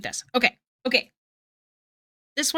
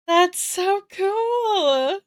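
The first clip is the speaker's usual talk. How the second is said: high, very slow joyful shout; delighted shout; 10 semitones higher, 4 dB louder, much slower than usual. Real, not acted. Streamer reacting to fan art, slow high delighted shout. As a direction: let the joy burst out.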